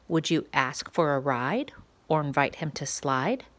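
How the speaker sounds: ordinary delivery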